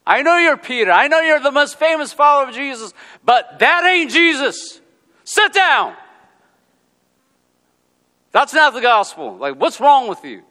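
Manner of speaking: high loud confrontational rebuke; Paul's rebuke. Retold or imitated. Paul rebuking Peter to his face, loud and high. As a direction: tell him off to his face. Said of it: "He told him to his face".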